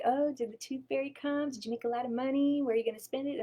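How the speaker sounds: put-on sweet voice for a kid; talking to a child. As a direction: coo to a little girl about the tooth fairy. Talking sweetly to little girl.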